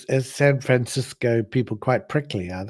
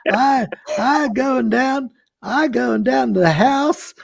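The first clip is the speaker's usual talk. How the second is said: put-on hillbilly accent; 7 semitones higher than usual. Showing co-hosts his practised hillbilly accent, high and drawled. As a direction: ham up a Southern hillbilly. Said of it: "let's hear your accent".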